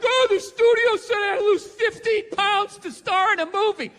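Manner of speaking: high, loud whiny complaint with a laugh; mock whining. Actress complaining about losing weight, loud mock whine. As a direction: whine like a pampered star.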